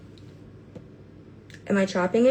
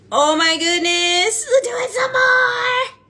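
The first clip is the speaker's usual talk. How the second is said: joyful cry; 13 semitones higher, 8 dB louder, slower than usual. Real, not acted. Overwhelmed with joy.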